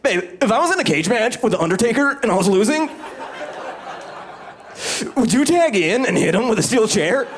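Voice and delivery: high insistent pleading voice; hammed-up demanding boyfriend; mock whiny boyfriend. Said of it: "I'm never like".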